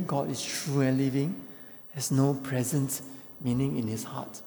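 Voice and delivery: usual speaking voice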